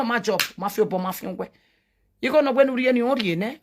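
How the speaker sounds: normal talking voice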